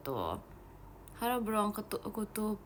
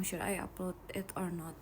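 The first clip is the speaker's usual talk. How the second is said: breathy unvoiced whisper; 3 semitones lower, 7 dB quieter than usual. Real, not acted. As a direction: whisper it to yourself. Whispering aside during live chat. (berbisik pelan)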